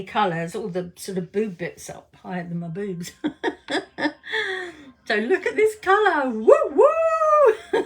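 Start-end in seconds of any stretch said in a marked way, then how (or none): 3.2-5.0 giggle. Real, not acted. Laughs at own joke. 5.0-6.3 laughing. Real, not acted. Showing off lipstick. 6.3-7.8 high, loud delighted whoops; excited whoop. Real, not acted. Delighted at colour.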